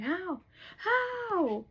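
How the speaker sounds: loud, high-pitched disbelieving wail; hammed-up astonished outburst; incredulous cry. Acted king's stunned demand.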